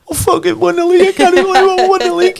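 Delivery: very high, squeaky blubbering imitation; mock tearful elation. Husband phones his missus after the title win, mock blubbering. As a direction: wail the big news down the phone, hammed-up.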